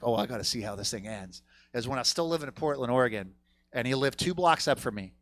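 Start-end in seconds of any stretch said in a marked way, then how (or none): 0.0-1.4 quiet aside. Retold or imitated. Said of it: "I was like".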